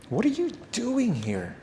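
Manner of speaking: high, slow gentle challenge; slow probing question. Retold or imitated. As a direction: draw the question out, prodding. God's question repeated to Elijah.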